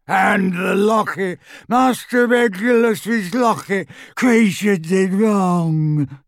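slow, high, wailing croak; distraught house elf screaming; anguished elf scream. A narrator's reading. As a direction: howl out the guilt in despair. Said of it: "he uttered a blood-curdling scream".

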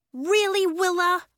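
high, loud disbelief; indignant, high-pitched reproach; exasperated. A narrator's reading. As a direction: scold your friend in disbelief. Nori exasperated at Willa's rain.

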